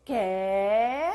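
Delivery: loud, drawn-out Catalan-style "Qué"; exaggerated Catalan questioning "Qué"; mock Catalan Qué. Shown as a demonstration. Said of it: "Como los cataluños".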